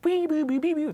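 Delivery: squealing high guitar riff sung as "wee"; guitar lead imitation. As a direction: mimic a screaming guitar solo with "wee wee wee". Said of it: "one of my guitar players does this, like, lead".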